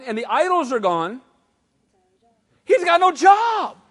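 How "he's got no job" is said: slow, loud, high emphatic call; preacher shouting the punchline. Real, not acted. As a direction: hammer the point home. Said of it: "preacher emphasizing".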